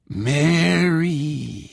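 high, drawn-out ghostly call; haunting, stretched-out summons; eerie ghost voice. A narrator's reading. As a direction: wail it like a spook calling from hiding. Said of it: "the voice whispered again".

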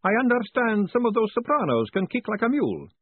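put-on high, lively old professor; chatty academic explaining cheerfully; professor's voice. A narrator's reading. Professor chats to Moist, high and lively.

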